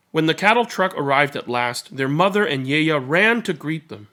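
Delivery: gentle read-aloud storytelling voice; story narration. A narrator's reading. Narrator reading a picture book to listeners, gentle storytelling.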